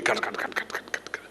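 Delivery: rapid "cut, cut, cut" fading to a whisper; vibrating noise. Shown as a demonstration. Swamiji imitates the vibrator shaking the bucket, fading away. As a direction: mimic a shaking machine, dying away.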